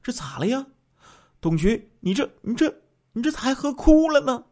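high-pitched, startled stammer; 吓了一跳. A narrator's reading. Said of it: "乡长一看吓了一跳". (吃惊地高声追问)

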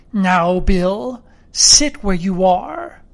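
lightly voiced menacing blind beggar; measured, cold command, slowing at the end; cruel, cold voice. A narrator's reading. Blind beggar orders the sick captain to stay put, coldly. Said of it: "a voice so cruel and cold and ugly".

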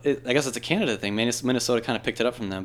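ordinary talk

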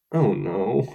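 flat, unbothered oh no; deadpan. Acted. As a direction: say it without any alarm. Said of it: "no change in facial expression".

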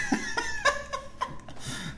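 long, extremely high squealing laugh; helpless laughter. Real, not acted. Cracking up at haircut picture.

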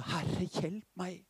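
slow, soft plea for help; a hint of a desperate mother, drawn out and soft; pleading mother. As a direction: beg the Lord slowly and quietly. Desperate mother begs Jesus.

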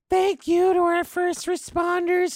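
high, slow, sugary mock cheer; mock cheerful neighbour. Comic mocking lockdown neighbours in a high sugary voice. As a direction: squeak it out like a sappy neighbour.

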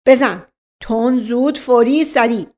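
loud, punched staccato orders; overbearing police command; bullying interrogator. Acted. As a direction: demand he talk instantly. Inspector orders him to talk.

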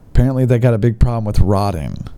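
ordinary talk, nothing marked

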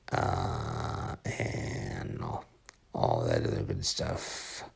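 drowsy, fading sign-off; sleepy mumbled goodbye; nodding off. Real, not acted. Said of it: "I'm falling asleep".

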